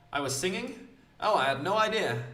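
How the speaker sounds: light put-on voice for Felipe; Felipe's voice. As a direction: voice the young Spaniard, surprised. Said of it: "I've been doing a wrong voice for that".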